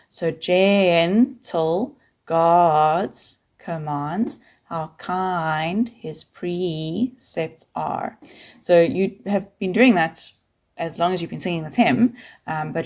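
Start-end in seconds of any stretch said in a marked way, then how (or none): 0.3-8.2 gentle God's commands drawn out very slowly; slow demonstration. Shown as a demonstration. Teacher drawing out the hymn line to show the slur. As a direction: stretch the slurred words out. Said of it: "you're singing two notes, but it's the same syllable".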